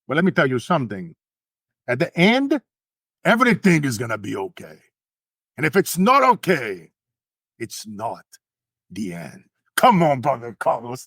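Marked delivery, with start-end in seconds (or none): 1.9-11.1 preaching. Real, not acted. Motivational preaching to the audience.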